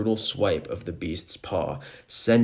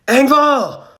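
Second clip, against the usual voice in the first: high, loud cry of a name; concerned shout; 13 dB louder than usual. A narrator's reading. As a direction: call out to a fallen friend, loud and high. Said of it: "Joseph looks back concerned".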